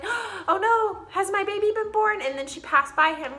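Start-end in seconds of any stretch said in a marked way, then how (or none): 0.0-2.2 mock gasp worry. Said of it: "the father was like".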